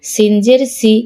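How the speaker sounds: no stretch on সি; short vowel. Shown as a demonstration. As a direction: end on a short সি. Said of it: "কোথায় দ্রুত পড়ি".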